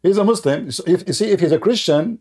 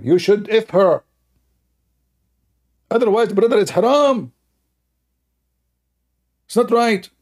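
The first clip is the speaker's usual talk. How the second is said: higher, hammed-up preacher advising; mock sheikh advice; 7 semitones higher than usual. The sheikh advising the groom, mock higher preacher voice. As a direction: play the sheikh preaching, pitch up. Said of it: "The sheikh is advising him".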